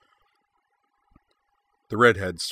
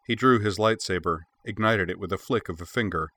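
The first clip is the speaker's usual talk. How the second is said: usual speaking voice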